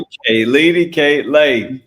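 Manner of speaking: loud hype repeat; echoing the punchline. Real, not acted. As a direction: shout it back slowly, savoring it. Co-host echoes punchline loudly.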